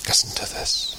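whispered, drawing listeners in; listen closely, whispered. Shown as a demonstration. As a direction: drop to a breathy whisper. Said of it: "repeated ever quieter".

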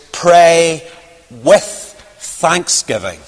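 "Pray", then a pause, then "with thanksgiving" slow and weighty; stressed phrase. Shown as a demonstration. Preacher stressing the key phrase of the text, slow and emphatic. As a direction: set apart "Pray" and draw out "with thanksgiving". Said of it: "the real powerful accent on the text".

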